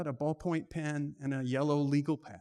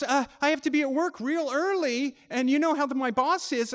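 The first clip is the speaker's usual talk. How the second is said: higher, apologetic brush-off; Clarissa's excuse; 9 semitones higher, 4 dB louder than usual. Retold or imitated. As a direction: make an awkward excuse.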